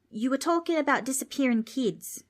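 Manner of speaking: a lightly voiced kid from down under; curious young Australian; cheeky Aussie kid. Darby reminds ghost.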